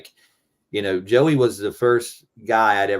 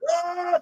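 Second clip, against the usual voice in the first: much higher short yell; a quick high war cry; Rambo yell. Shown as a demonstration. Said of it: "the key of Rambo".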